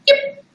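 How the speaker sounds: tiny squeaky yip; high yip imitation. Owner imitating her small dog's yip, squeaky. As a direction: yip like a little yappy dog. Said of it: "And Spook's like".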